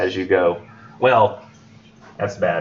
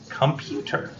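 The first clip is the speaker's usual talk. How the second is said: whispered word; 4 semitones higher, 7 dB quieter, slower than usual. Shown as a demonstration. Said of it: "if we write the word computer".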